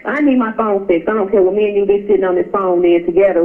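ordinary delivery

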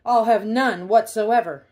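loud scoffing shout. Acted. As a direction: bark it back in outrage. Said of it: "But Pig shouted".

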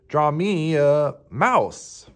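slow, drawn-out meowing cat; storybook cat, long swooping vowels; Mildred. A narrator's reading. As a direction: stretch it into a cat's meow. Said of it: "meowed Mildred".

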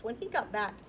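ordinary delivery

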